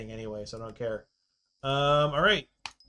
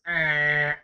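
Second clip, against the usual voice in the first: loud, higher imitated "aah"; imitated creature cry; 4 semitones higher, 8 dB louder than usual. Streamer mimicking what came out at them, imitated cry. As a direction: mimic a sudden alarming noise. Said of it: "That was mildly terrifying, just the way".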